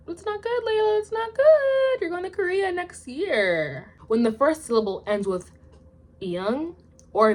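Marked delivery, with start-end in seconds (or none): 0.1-3.9 high, drawn-out whiny chiding; exaggerated high-pitched lament; mock self-scolding. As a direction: playfully scold yourself in a high, stretched voice. Mock self-scolding, addresses herself by name.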